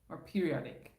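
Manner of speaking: ordinary talk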